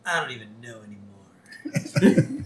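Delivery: slow, deadpan imitation of a teen; imitated deadpan, jerk or just talking. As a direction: say it slowly, giving nothing away. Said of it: "he was just so deadpan".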